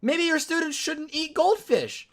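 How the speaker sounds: loud, high outraged rant; acted-out furious protest, voice climbing; heated. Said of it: "So I'm heated. I'm mad".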